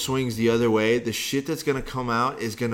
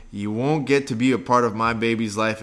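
usual speaking voice

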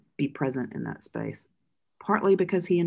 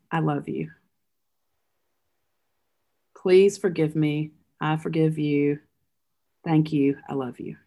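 slow, tender and deliberate, each phrase set apart; a slow, loving farewell at a deathbed; gentle bedside words; slower than usual. Shown as a demonstration. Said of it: "eleven words you say at a bedside".

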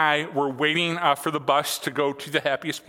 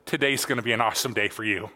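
sarcastic; 6 semitones lower, faster than usual. Retold or imitated. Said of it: "in his sarcasm".

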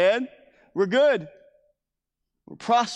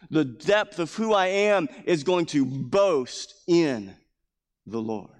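ordinary delivery

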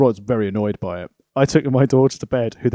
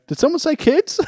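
high, leering question; mock creepy eager voice. Lawler turning round at the dinner table, leering. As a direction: lean in eagerly at the word kids. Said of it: "Jerry Lawler turns around going".

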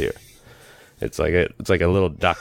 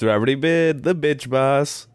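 catchphrase voice; 5 semitones higher than usual. Acted. Catchphrase intro bit.

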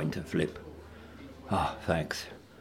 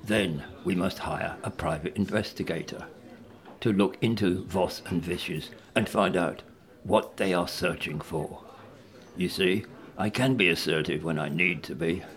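ordinary talk, nothing marked

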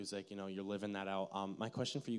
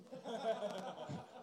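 real amused laughter; laughter at a joke; 11 semitones higher, 5 dB quieter than usual. Real, not acted. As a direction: burst out laughing. Joke about being lactose intolerant.

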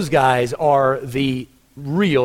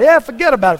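put-on "forget about it" in a higher, louder New York accent; imitating New York "forget about it", higher and louder; 8 semitones higher, 7 dB louder, faster than usual. Pastor mimicking his New York friend, New York accent. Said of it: "that accent".

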